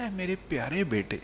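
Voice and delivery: hushed, tender call to a son; soft fatherly affection; very loving. Acted. Father addresses his beloved son, lovingly. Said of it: "بڑے پیار سے کہا".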